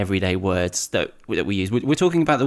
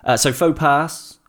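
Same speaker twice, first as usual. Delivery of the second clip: ordinary talk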